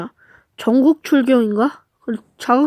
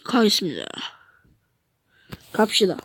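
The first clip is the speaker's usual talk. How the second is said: ordinary delivery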